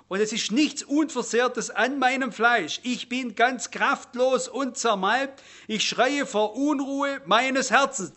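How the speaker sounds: slow, emphatic Psalm recitation, pitched a little up; deliberate, intense Bible reading; scripture reading. Acted. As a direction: recite the lament with drawn-out, weighted words.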